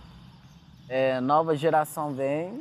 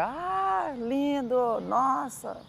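high swooning "ah, lindo, nossa" in a much higher voice; imitating admiring woman; 9 semitones higher, much slower than usual. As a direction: squeal it like a woman dazzled by his dance. Imitating admiring woman while he dances. (imitando a mulher encantada, voz bem mais aguda)

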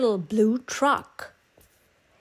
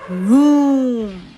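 mild engine vroom; 7 dB louder than usual. A narrator's reading. Dump truck revving off.

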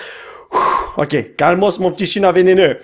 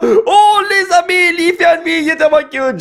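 excited gushing; 11 semitones higher than usual. Real, not acted. Player shows friends a cute card pull, squealing with delight. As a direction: squeal fondly over a treasure.

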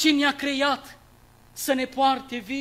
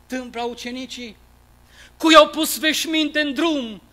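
usual speaking voice

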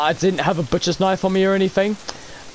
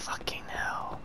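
whispered curse; 17 dB quieter than usual. Real, not acted.

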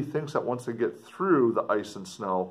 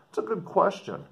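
plain reading voice

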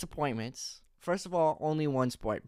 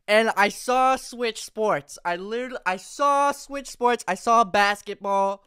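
excited; 5 semitones higher, 4 dB louder than usual. Real, not acted. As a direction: savour each word in delight. Sees announcement he hoped for.

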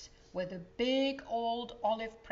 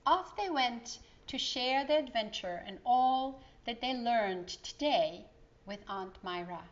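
ordinary delivery

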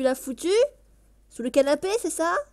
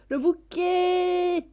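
high, very slow "bouquet", last vowel held long; drawn-out delight; 4 semitones higher, much slower than usual. Real, not acted. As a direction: stretch the end of "bouquet" in a high held note. Repeats it, stretching the word. (« bouquet » ravi, très étiré)

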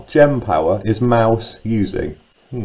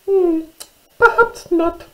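very high, squeaky mouse voice; little mouse; slower than usual. Acted. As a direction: squeak it out like a tiny storybook mouse. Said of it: "said Little Mouse".

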